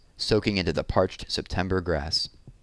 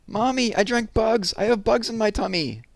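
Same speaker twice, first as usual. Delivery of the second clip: put-on wailing little girl. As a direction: cry out to mommy in horror. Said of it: "The girl started wailing".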